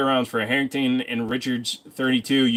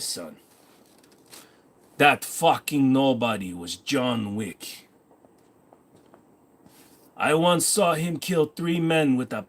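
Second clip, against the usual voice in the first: usual speaking voice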